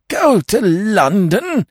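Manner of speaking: high, slow, horrified question; appalled gasp. Acted. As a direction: gasp it out in horror. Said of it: "gasped ... appalled".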